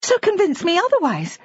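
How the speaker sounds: high defiant dare; challenging. A narrator's reading. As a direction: throw it back at him as a challenge. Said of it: "she challenged".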